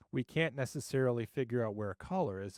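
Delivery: ordinary talk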